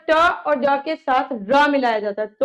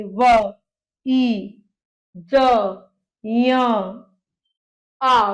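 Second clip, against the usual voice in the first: each sound stretched, pauses between; teacher modelling separate sounds; slow sounding out; 2 semitones lower, much slower than usual. Shown as a demonstration. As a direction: spell it out sound by sound, slowly. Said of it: "अब धीरे धीरे बोलिए".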